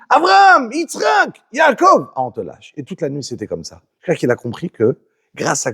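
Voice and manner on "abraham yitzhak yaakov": Esav crying out. Retold or imitated. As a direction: shriek the three names. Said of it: "Et il crie". (Esav crie les noms, voix aiguë jouée)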